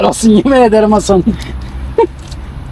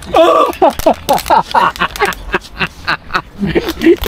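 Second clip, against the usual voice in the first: long giggling laugh; 2 semitones higher, 3 dB quieter than usual. Real, not acted.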